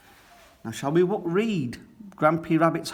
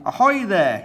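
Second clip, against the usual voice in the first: higher, louder hail across the water; calling out; 7 semitones higher, 7 dB louder than usual. A narrator's reading. Grampy Rabbit hails the stuck boats, calling loudly. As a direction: call out to the stranded sailors. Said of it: "he calls".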